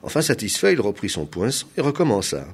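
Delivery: plain reading voice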